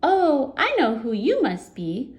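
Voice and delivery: high, loud goblin chirp. Acted. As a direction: play the cheeky goblin. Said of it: "said the goblin".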